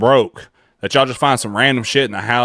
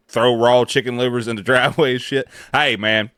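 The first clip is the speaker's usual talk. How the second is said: normal talking voice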